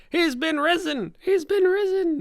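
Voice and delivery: high, slow, wailing proclamation; shrill-free high swooping chant of the faithful; ecstatic crowd cry. Retold or imitated. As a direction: cry it out like awestruck townsfolk. NPCs running around proclaiming martyr.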